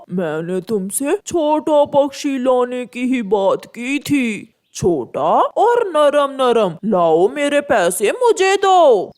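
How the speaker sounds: owl buying the chick; greedy owl. Acted. Owl buyer gloating.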